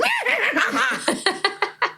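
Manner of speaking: shrill giggle mimicking silly adults; high mocking laugh. Mocking the pony players with a high laugh. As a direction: laugh high like a prancing grown-up.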